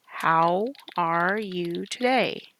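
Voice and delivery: very slow dictation; dictating slowly. Shown as a demonstration. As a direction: stretch each word as you type. Teacher dictating slowly while typing.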